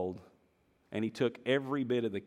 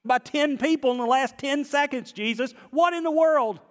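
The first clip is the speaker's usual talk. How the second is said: much higher and louder, exasperated protest; exasperated disciples; 7 dB louder than usual. Acted. Disciples telling Jesus everyone is bumping him, loud and high. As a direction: throw up your hands at the pushing crowd. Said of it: "They said".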